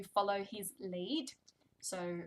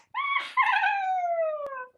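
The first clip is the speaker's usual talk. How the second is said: very high loud gasp; huge mock gasp; 10 dB louder than usual. Shown as a demonstration. Reading to toddler, hammed-up gasp. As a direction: gasp in delighted shock.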